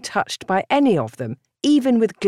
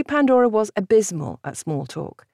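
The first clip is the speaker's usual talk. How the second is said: usual speaking voice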